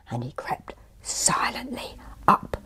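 whispered, suspenseful storytelling; hushed narration. A narrator's reading. Storyteller describing tiger creeping to pond, hushed. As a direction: hush it as if creeping up.

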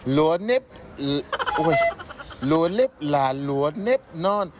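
foreigner vietnamese. Shown as a demonstration. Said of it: "Và cho [name] đọc lại một lần nữa".

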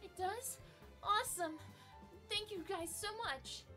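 very high, thrilled adventurer voice; excited young NPC. Acted. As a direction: burst out with delight.

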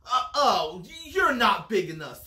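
grouchy bug backing out; grouchy ladybug. A narrator's reading. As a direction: start on an uh-oh, then bluster loudly. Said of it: "said the grouchy ladybug".

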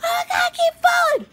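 high, squealing panicked victim; shrill imitated scream of a falling victim; mock horror victim. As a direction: ham up the clumsy victim. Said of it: "would run in the forest and fall like".

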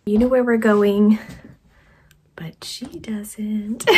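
ordinary talk, nothing marked

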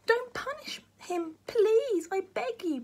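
put-on high, slow pleading child voice; pleading boy. A narrator's reading. Jack begs the matron to spare grandpa, high pleading. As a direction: beg in a high boy's voice. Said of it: "pleaded Jack".

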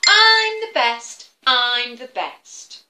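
chanting I'm the best, proud and slow; boastful eagle. Acted. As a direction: crow it out like a show-off eagle. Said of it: "What does he say?".

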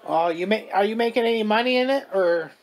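ordinary talk

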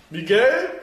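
loud, high call across the room. Real, not acted. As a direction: shout the name to get attention. Calling for help again.

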